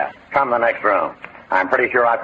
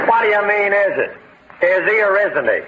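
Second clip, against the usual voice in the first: impatient demand; 7 semitones higher than usual. Acted. Sheriff presses witness.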